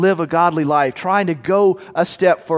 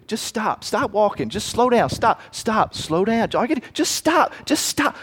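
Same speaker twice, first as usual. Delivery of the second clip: higher, coaxing tempter urging him to stop; sweetly persuasive devil, higher pitched; tempting voice; 5 semitones higher than usual. Acted. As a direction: lull the traveler into quitting. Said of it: "one of Satan's big things".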